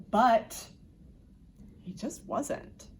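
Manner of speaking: usual speaking voice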